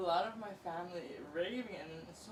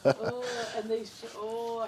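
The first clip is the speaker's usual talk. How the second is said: laughing; 4 semitones higher, 8 dB louder, much slower than usual. Real, not acted. Laughs at the show.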